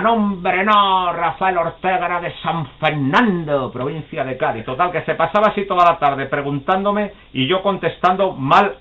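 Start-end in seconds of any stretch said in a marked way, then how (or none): 0.0-4.7 put-on exasperated old man correcting. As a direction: drag out the correction like a fed-up old aficionado.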